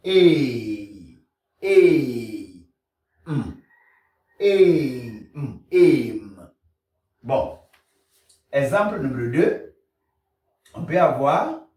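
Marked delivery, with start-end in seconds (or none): 0.0-6.5 long AY for "Et", hummed M, blended into "Aim"; sounding out "Aim". Shown as a demonstration. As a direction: build "Aim" slowly from AY and M. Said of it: "ça devient aim". (« Aim » décomposé lentement, « éï » puis M)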